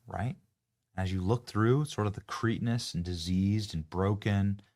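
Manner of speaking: normal talking voice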